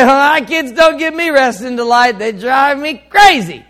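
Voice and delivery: high and loud, a fed-up parent's scoff; exasperated parent. Retold or imitated. A congregant answering the pastor, high and loud in exasperation. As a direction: play the parent whose kids drive them crazy. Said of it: "You say".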